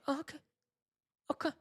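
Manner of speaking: small, high, soft acknowledgement; placating brush-off. Retold or imitated. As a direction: humour them in a tiny high voice. Acts out placating meddling vendors.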